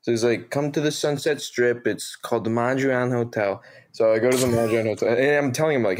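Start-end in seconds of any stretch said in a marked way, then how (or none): 0.5-3.6 lightly imitated Jersey accent; a hint of East Coast guy accent; Dave Latour. Bar manager inviting a kid to his hotel, light Jersey accent. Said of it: "this very, like, Jersey".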